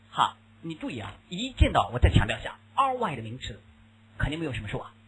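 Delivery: ordinary delivery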